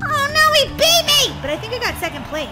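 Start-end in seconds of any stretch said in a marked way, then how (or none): 0.1-1.4 high, loud wail of defeat; dismayed outcry. Real, not acted. Gamer just beaten in a race, dismayed outcry. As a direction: cry out at losing.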